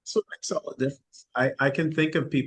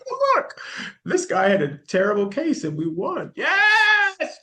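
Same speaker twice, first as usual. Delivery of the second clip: much higher, loud shriek of joy; excited screaming; 14 semitones higher, 4 dB louder, slower than usual. Retold or imitated. Lawyer learns she won a client's case, screaming with joy. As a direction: yelp it out like you just won big. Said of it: "Just, like, screaming".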